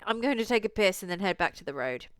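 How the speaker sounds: normal talking voice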